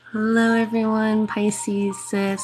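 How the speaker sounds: normal talking voice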